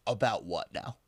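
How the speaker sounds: low, hushed mock confusion; retold wary reply. Storyteller answering an accusation at a party, quietly puzzled. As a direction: answer small and quiet, imitating your puzzled self.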